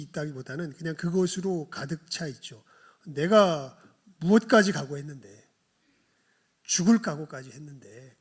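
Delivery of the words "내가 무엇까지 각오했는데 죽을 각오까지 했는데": loud, boastful Peter; confident outburst; bold proud shout. Acted. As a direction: declare it proudly and loudly.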